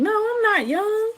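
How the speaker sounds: playfully offended denial; indignant protest. Real, not acted. Protesting being called young.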